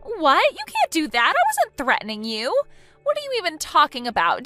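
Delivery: high, loud shocked protest; shocked denial. Acted. Fiancée accused of threats, shocked loud denial.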